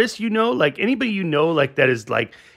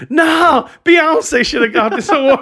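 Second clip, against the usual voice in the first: high, loud mock outburst; 10 semitones higher, 8 dB louder than usual. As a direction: shout it like crashing the stage. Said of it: "fucking was like".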